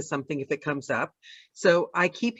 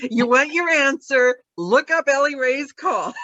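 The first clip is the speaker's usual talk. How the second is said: mock answer; 7 semitones higher, 4 dB louder, slower than usual. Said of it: "Be like".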